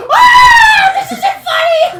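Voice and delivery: high, slow laughing yell; laughing mock rant. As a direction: whine it out high through laughter. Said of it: "I'm like".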